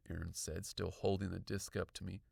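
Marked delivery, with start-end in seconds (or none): none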